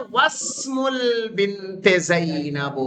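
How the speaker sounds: واسم said slowly as one word, the waw joined straight to the seen; joined pronunciation. Shown as a demonstration. Teacher showing students how the words join, slowly. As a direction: glide the waw straight into سین with no alif. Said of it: "واو کو سیدھے سین سے ملا دیں گے".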